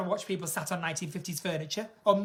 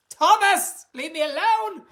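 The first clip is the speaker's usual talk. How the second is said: put-on old mother voice; 7 semitones higher, 7 dB louder, slower than usual.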